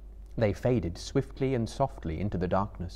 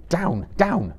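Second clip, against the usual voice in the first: raised, firm Frodo; stern command; 9 semitones higher, 5 dB louder than usual. A narrator's reading. Frodo ordering Gollum off his knees, firm command. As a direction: order the fawning creature down. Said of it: "said Frodo".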